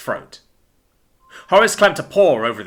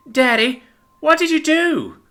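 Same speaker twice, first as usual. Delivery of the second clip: small high voice, stricken; moaning. A narrator's reading. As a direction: plead with daddy in dismay. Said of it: "moaned poor Scotty".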